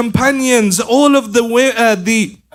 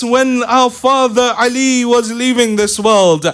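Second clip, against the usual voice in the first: ordinary delivery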